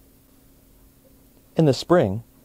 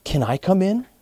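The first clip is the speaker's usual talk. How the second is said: much higher hopeful bunny; bunny asking; 8 semitones higher than usual. A narrator's reading. Bunny asks to share the home, higher voice. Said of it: "said the bunny".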